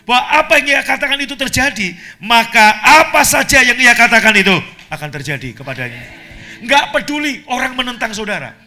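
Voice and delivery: loud, high, emphatic sermon delivery; ringing preacher's declaration; full-force preaching. Real, not acted. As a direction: proclaim it to the congregation with mounting force.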